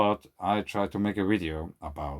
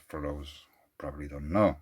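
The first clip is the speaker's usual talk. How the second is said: a quick whisper tucked in as an aside; 3 semitones lower, 5 dB quieter than usual. Real, not acted. Aside to viewers while reading his email, whispered. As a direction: drop to a whisper for a side note.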